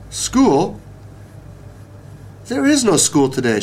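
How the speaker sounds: high, startled realization; surprised cry. A narrator's reading. Girl realizing there is no school, crying out in surprise. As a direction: start high on the sudden discovery, then exclaim. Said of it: "she cried".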